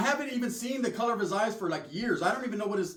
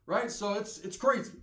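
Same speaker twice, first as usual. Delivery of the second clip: usual speaking voice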